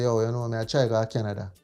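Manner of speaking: usual speaking voice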